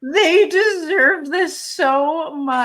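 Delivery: high, slow, teary wail through a laugh; reliving crying on the couch over the team, high teary wail; mock sobbing. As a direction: sob it out while half laughing. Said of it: "I was literally crying".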